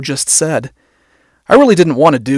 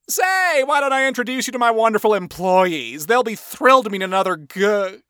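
high, showy boss voice; wacky boss; 7 semitones higher, 4 dB quieter than usual. Acted. Boss showing a client off to employees, wacky. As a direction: play the boss gushing about his crew.

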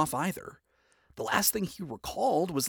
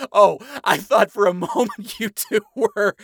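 louder and higher, laughing through words; words broken by laughter; 7 semitones higher, 10 dB louder than usual. A narrator's reading. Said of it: "burst into laughter".

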